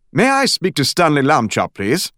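polite British caller; a hint of a British detective on the phone; British accent. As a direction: ask for him in a light British accent. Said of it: "It also had an accent".

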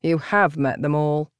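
low, gloomy delivery; darkly. A narrator's reading. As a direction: say it darkly and dryly. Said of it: "Pandora had said darkly".

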